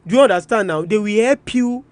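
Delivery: usual speaking voice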